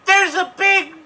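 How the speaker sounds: high, loud, frantic cartoon rabbit voice; Roger Rabbit. Improviser shouting as Roger Rabbit to audience, high manic cartoon voice. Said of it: "I'm Roger Rabbit!".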